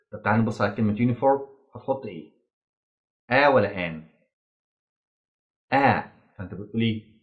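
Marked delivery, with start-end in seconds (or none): none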